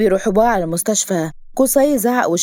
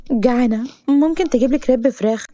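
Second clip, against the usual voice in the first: higher, coaxing request; pitched-up cajoling heroine; wheedling heroine; 5 semitones higher than usual. Acted. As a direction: ask like a hungry patient. Heroine asks for food sweetly.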